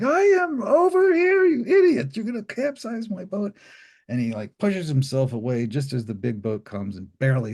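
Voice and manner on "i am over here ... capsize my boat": angry screaming. Retold or imitated. Fisherman screaming at the big boat, high furious yell. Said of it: "Then he gets really angry at the big boat".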